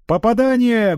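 high, loud commentator cry; sports commentator shout. A narrator's reading. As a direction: call the hit like a sportscaster. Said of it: "голосом спортивного комментатора выкрикнула".

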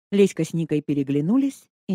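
ordinary delivery

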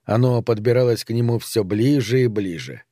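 ordinary delivery

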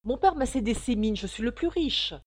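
loud boastful insistence; exclaiming. A narrator's reading. Rival suitor boasts of his wealth, loud and insistent. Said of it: "s'écria l'autre".

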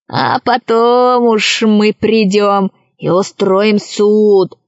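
slow, drawn-out pirate drawl; storybook villain, leisurely and self-important; pirate Krys deliberating. Acted. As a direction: lay down the plan lazily, dragging each word.

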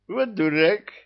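snorting laugh. Acted. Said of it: "захрюкал Люцифер".

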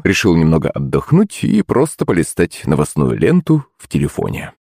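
ordinary delivery

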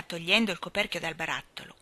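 usual speaking voice

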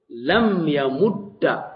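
slow لم يمد, pronounced "lam yamudda"; final fatha. Shown as a demonstration. Teacher demonstrating the first allowed form, يمد ending in a. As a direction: draw out لم يمد, ending the doubled d on a. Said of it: "إما بفتحة".

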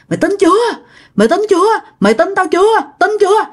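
high, insistent repeated demand; insistent taunting. Acted. Ghost woman biting the passenger demands belief, high insistent taunt.

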